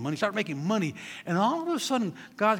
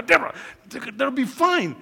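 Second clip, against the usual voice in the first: soothing; 7 semitones higher, 6 dB louder than usual. Retold or imitated. Placating wife, drawn out.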